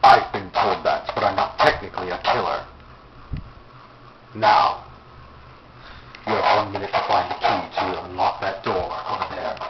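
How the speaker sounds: low, menacing horror-villain voice; psychopath killer voice. Acted. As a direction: speak like a sinister puppet master. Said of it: "So you're some psychopath killer".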